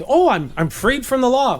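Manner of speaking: playfully acted elation; mock relieved excitement. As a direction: exclaim it like a naive discovery. Acting out his former naive reaction.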